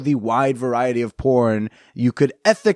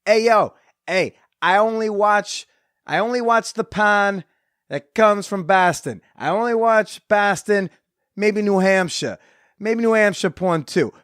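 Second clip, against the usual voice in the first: lightly put-on Boston accent, pitched higher, r's not dropped; 7 semitones higher than usual. Patriots fan bragging about his porn, in a mild Boston accent. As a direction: play a New England bro in a half-done Boston accent. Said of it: "to properly do a Boston accent".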